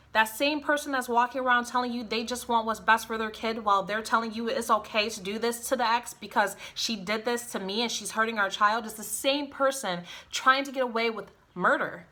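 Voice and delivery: normal talking voice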